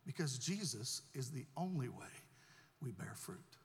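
ordinary talk, nothing marked

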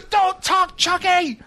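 very high, hammed-up scolding; mock yell at a misbehaving mascot; strained, high-pitched host imitation.

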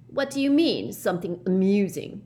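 sharp, high, annoyed retort; sulky irritation; irritable. A narrator's reading. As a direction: snap back at the teacher. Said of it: "he said irritably".

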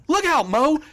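high, loud warning shout; panicked commentator cry; mock panicked yell. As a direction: yell the warning like a frantic announcer. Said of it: "Jerry Lawler just goes".

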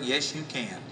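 usual speaking voice

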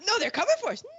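mock-frightened country pleading; panicky Czechoslovakia. Quarrel of two voiced countries.

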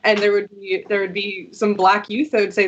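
normal talking voice

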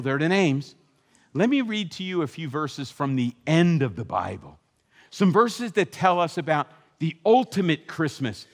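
ordinary talk, nothing marked

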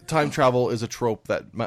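ordinary talk

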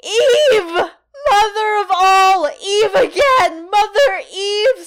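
high, loud, drawn-out wail; stammering sobs. A narrator's reading. Tortured witch giving up the name, sobbing. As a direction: sob each word out slowly at full pitch. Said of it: "stammered Lena Felt, sobbing".

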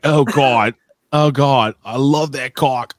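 high mock New England accent; New England girlfriend. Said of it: "Salem, New Hampshire".

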